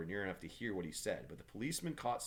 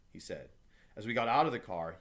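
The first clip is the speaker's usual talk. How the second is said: plain reading voice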